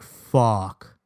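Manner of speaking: low, heavy curse; sinking dread. Retold or imitated. Boy learns parents will be told, low sinking dread. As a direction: drop the pitch as it sinks in.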